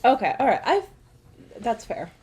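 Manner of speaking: ordinary delivery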